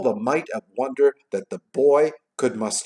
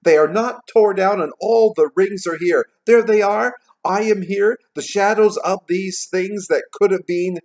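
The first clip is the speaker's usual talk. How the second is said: high, loud, relieved exclaiming; excited cry; 9 semitones higher, 8 dB louder than usual. A narrator's reading. Scrooge finding everything still there, crying out. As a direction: cry each discovery out, high and emphatic. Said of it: "cried Scrooge".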